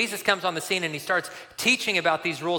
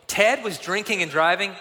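shocked, rising question; alarmed disbelief; 3 semitones higher than usual. Retold or imitated. Leader hearing the boy's report, alarmed and incredulous. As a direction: echo it back, aghast. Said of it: "noticeably alarmed".

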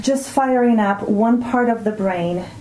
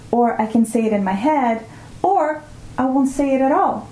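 ordinary talk, nothing marked

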